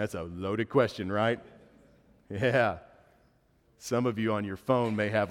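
normal talking voice

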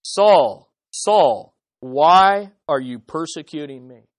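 loud, booming call from heaven; voice of Jesus. Acted. The risen Jesus calling to Saul, loud and booming. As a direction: call out Saul's name with divine weight.

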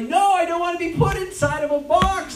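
high-pitched indignant refusal; acted protest. Speaker resisting the labels society gives him, acted protest. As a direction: push back hard, pitch well up. Protesting against being boxed in.

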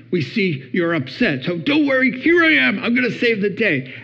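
mock-heroic, pitched high; hero to the rescue. Husband saving upset wife's day, mock-heroic reassurance. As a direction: announce yourself as her saviour, playfully overdone.

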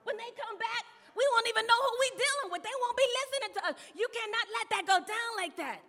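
loud acted protest, climbing high; pressuring the king. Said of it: "They put the pressure on him".

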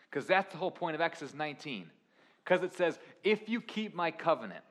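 rally the congregation with fire; full-force preaching. Acted. Preacher drives the point home to the church, full force.

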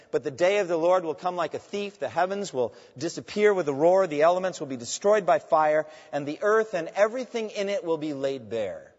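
high, loud pulpit proclamation; declaimed scripture reading. Acted. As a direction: proclaim the verse with weighty emphasis. Reads scripture out forcefully.

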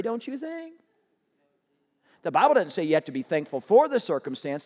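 ordinary delivery

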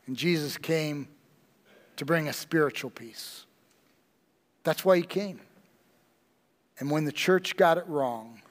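ordinary delivery

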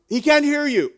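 a high, loud sarcastic jab at the prophets; mocking taunt. Elijah taunting the prophets of Baal, high and loudly mocking. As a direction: needle them: your god isn't listening.

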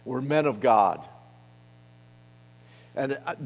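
usual speaking voice

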